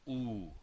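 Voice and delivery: long rounded oo; U as a long rounded oo, like "oo" with an h on the end; German U. Shown as a demonstration. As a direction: clear German U. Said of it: "like a double O in English".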